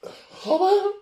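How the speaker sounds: high, playfully choked-up voice; mock emotional. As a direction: get all emotional over food, half-joking. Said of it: "I'm getting emotional".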